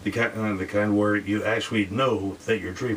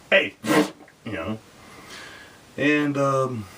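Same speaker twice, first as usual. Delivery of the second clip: normal talking voice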